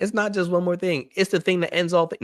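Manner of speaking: high-pitched mock protest; imitated objectors. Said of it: "Some people are like".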